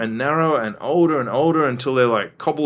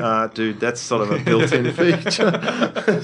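amused retort; slower than usual. Retold or imitated. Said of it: "I'm like".

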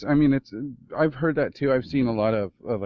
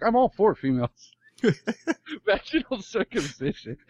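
imitating the podcast host; 10 semitones higher, 3 dB louder than usual. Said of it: "you're just like".

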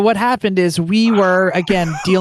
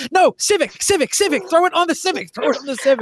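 excited organizers; 7 semitones higher than usual. Retold or imitated. Organizers deciding the flag.

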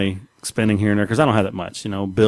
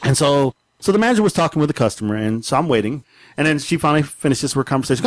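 ordinary talk

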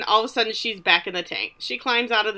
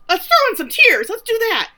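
high, loud burst; mock desperate resolve; 13 semitones higher, 9 dB louder than usual. Woman talking herself into fake tears, loudly worked up. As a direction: act out a frantic change of tactics. Acts her switching to desperate tactics.

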